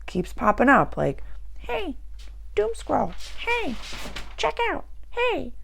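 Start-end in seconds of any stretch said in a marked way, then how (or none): 1.6-5.5 high, thin tempting voice calling out; much higher teasing voice of a bad habit; nagging inner voice. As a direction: play the little voice that keeps pestering you. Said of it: "It's probably gonna sound like that".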